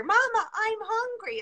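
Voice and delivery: high pleading little voice; child's plea. Retold or imitated. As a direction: tug at the heartstrings like a child. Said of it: "when you hear".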